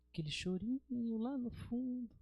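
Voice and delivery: faint far-off cry. Retold or imitated. Preacher evokes a child's faint cry at night, faint far-off voice. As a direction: say it small and distant, like faint crying.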